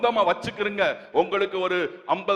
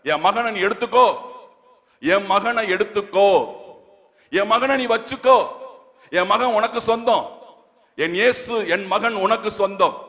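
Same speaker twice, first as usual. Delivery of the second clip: raised, emphatic phrases with pauses between; impassioned preaching; 4 semitones higher, 5 dB louder than usual. Acted. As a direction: proclaim God's offer to the congregation. Preaches God offering his son.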